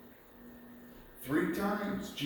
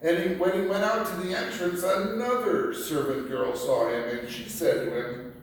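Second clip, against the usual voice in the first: ordinary delivery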